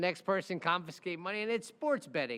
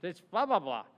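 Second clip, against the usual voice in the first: imitating client; 5 dB louder than usual. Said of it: "this is literally how he talks".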